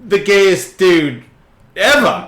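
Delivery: loud, drawn-out, high retort; exasperated disbelief. Retold or imitated. Heckling the actor in the video, loudly incredulous. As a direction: stretch out every word in mock exasperation.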